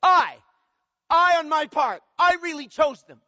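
each "I" hit high and loud, with a pause after the first; high, insistent stress on "I", set apart by pauses; emphatic subject stress. Shown as a demonstration. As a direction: punch every "I" forcefully to claim the act. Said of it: "middle voice".

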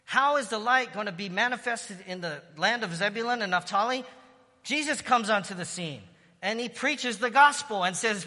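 high, loud fervent preaching; preaching at full force. Real, not acted. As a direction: proclaim the king has come, full voice. Preaching climax, voicing Jesus.

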